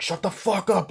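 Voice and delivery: imitated angry outburst; acted bully threat. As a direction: order them silent, high and heated. Acting out bullies' threats.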